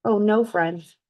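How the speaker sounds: ordinary delivery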